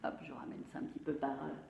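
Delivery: quick whisper to herself; quick whispered aside while moving the board. Real, not acted. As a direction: hush it fast, just for you. Muttering aside while moving things.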